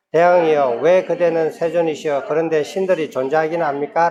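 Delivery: measured, solemn recitation of a Buddhist text; sutra reading. Acted. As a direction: read the sutra out like a chant leader. Reads the sutra passage aloud.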